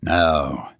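low, lightly voiced sick man; a hint of a weary patient; sick master. A narrator's reading. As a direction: answer low, as the ailing master. Sick master answers his servant.

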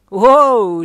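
loud, drawn-out teasing exclamation; smirking. Acted. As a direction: draw it out loudly with a smirk. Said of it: "she replied with a smirk".

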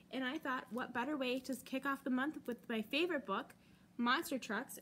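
ordinary delivery, nothing marked

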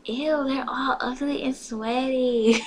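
drawn-out, grossed-out whine; disgusted. A narrator's reading. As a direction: recoil in exaggerated disgust. Reacting to boys with disgust.

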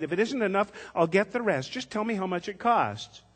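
put-on a child standing her ground; determined little girl. A narrator's reading. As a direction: Tess insisting to the pharmacist. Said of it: "she said".

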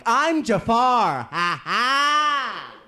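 loud, slow evil villain cackle; villain laugh. Retold or imitated. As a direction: gloat like a cartoon villain. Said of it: "I'm like".

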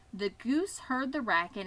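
plain reading voice

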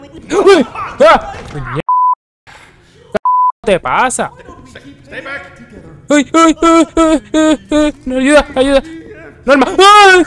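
high frightened shrieks; real panic yelps; startled screaming. Real, not acted. Jump-scared by the monster, screaming high in fright.

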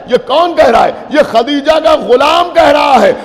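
preacher rousing the crowd to force; fiery preaching. Acted. Majlis speech at full force.

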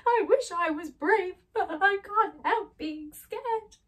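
tearful. Acted. Crying lion tells Dorothy he wishes he were brave. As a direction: plead it through tears.

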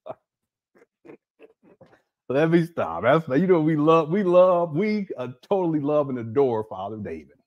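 ordinary delivery

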